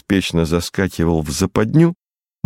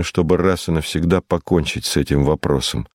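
plain reading voice

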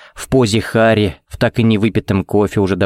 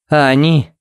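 higher, pained question; voice breaking; 5 semitones higher than usual. A narrator's reading. Harry asking Phoenix about the townspeople, voice breaking. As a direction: lift the question as if the voice gives way. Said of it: "Его голос преломился".

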